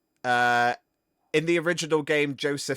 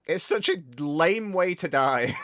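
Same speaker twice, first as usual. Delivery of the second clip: ordinary talk, nothing marked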